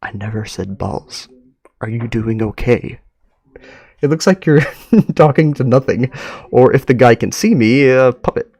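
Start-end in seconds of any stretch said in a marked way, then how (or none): 0.0-3.0 mildly confused. Acted. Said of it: "The stranger sounds mildly confused".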